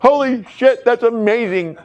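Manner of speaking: excited. Pretend. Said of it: "you're like".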